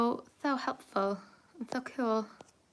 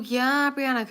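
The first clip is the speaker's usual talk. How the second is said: ordinary delivery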